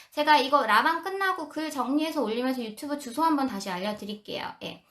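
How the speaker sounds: usual speaking voice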